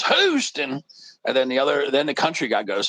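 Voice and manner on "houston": Houston said high in a put-on Texas drawl. The stranger answers the country guy in a Texas drawl. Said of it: "But the guy goes".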